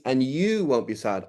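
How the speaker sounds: slow, rising swell on YOU; stress on YOU. Shown as a demonstration. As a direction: make YOU the whole contrast, drawn out. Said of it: "so the implication is".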